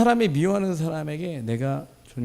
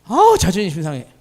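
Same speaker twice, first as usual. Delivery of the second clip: mock offended; 4 semitones higher, 11 dB louder than usual.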